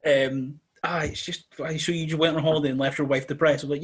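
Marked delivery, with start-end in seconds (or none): none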